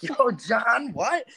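mock double take at seeing an old friend; surprised greeting. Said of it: "And I'm like".

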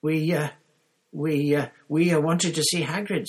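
high, hesitant stammer; small voice. A narrator's reading. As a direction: sheepishly falter through an explanation. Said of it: "in a rather small voice".

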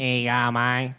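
soft, mouth barely closing, broad Aussie; quiet broad Australian mumble; mumbled vowels. Retold or imitated. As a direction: run it together with the mouth open. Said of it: "just a bunch of vowels".

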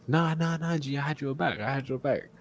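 high-pitched imitation of a classmate; pleading denial. Acting out classmate's excuse.

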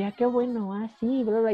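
mildly gushing small talk; overly friendly. Retold or imitated. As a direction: play lightly at being over-nice. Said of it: "soy como muy amable".

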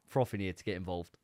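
ordinary talk, nothing marked

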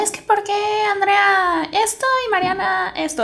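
put-on high, soft, whiny child's complaint; lightly voiced sulky sisters; whiny kids complaining. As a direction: mimic a kid whining to mom. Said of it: "como de queriendo como quejarnos".

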